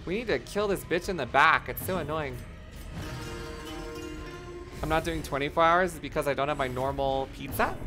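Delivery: ordinary talk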